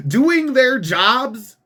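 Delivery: incredulous echo. Real, not acted. Scoffing at the mayor's statement, slow incredulous echo. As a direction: drag out Doing their jobs in mocking disbelief.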